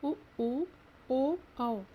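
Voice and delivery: उ, ऊ, ओ, औ one by one, lips rounded; rounded vowels. Shown as a demonstration. Teacher asking students to say round-lipped vowels, rounded and separate. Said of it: "गोला गोला हो जाता है".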